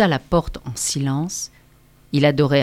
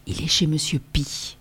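hushed, much softer, respectful; whispered; 7 dB quieter than usual. A narrator's reading. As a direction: murmur it with a knowing tone. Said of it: "On chuchotait".

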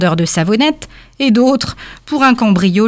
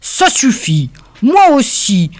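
léger agacement; 4 semitones higher, slower than usual. A narrator's reading. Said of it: "s'énerva le Père Noël".

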